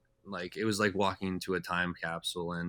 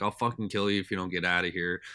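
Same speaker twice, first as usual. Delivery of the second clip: normal talking voice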